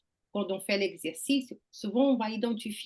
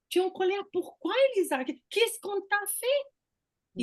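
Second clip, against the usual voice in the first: concerned questioning friend; 8 semitones higher than usual. Retold or imitated. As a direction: ask like a caring friend who wants to know. Quoting someone asking why angry.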